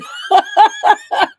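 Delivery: a big high burst of real laughter; loud self-mocking laugh. Real, not acted. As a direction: laugh hard at yourself. Laughs at getting one layout done.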